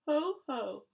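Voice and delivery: high, loud mock laugh; scoffing. A narrator's reading. Templeton scoffing at the pig's plea, loud mock laugh. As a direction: scoff with two loud hos.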